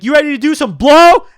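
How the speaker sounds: very loud, high-pitched yell. Retold or imitated. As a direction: yell it at him to get through. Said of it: "you just gotta yell at him".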